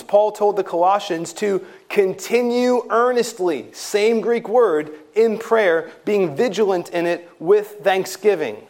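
building sermon emphasis; preaching. Acted. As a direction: press each point home from the pulpit. Preaching held higher.

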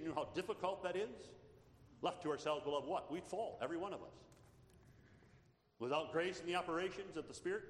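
loud, high, impassioned sermon climax; fervent preaching. Acted. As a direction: preach it out with full force to the congregation. Preacher raising to full force.

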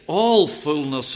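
"All" slow and loud; stress on ALL. Shown as a demonstration. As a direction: lean hard on "All fullness". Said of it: "We've drawn attention to those words".

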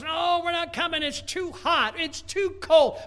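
mock shout. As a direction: yell the excuses like a whiny crowd. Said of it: "The world says".

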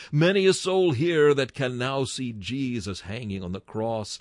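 ordinary talk

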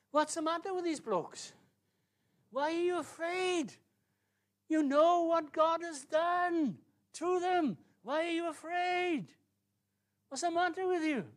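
high, loud, drawn-out incredulity; soaring pulpit exasperation; exasperated preaching. Acted. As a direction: cry out in disbelief at their fear.

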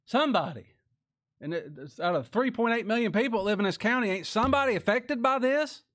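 high, loud, pleading preacher's cry; preaching at full force. Real, not acted. Preacher to congregation, crying out high and loud. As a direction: call out to the whole county, pleading.

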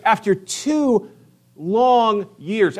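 after two long years, slow and high; stretched emphasis. Shown as a demonstration. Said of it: "But there's emphasis".

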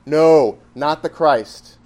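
loud, firm NO, then a slow "not the Christ"; emphatic denial. Retold or imitated. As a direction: hit "No" hard, then stretch out the denial. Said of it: "his emphatic denial".

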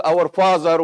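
usual speaking voice

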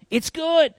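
"It's good." repeated high and bright with emphasis. Shown as a demonstration. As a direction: lift "It's good." way up in pitch.

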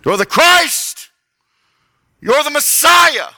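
high, loud, slow declaration; proclaiming cry. Retold or imitated. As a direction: shout the claim in awe. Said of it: "he claims it".

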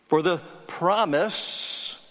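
slow, drawn-out For the promise with weight on the promise; stress on promise. Shown as a demonstration. Preacher stressing the promise to the congregation, slow and weighty. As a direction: lean hard on the promise, slowly. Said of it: "I emphasize that word".

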